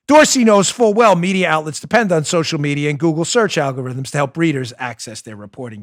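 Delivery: usual speaking voice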